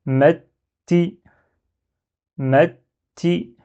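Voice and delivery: "Metti" as "met", pause, "ti"; shadda with kasra. Shown as a demonstration. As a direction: linger on the doubled t of "Metti". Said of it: "je dois m'attarder un petit peu".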